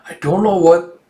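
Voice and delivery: ordinary delivery, nothing marked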